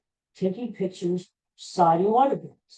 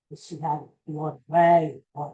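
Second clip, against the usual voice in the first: normal talking voice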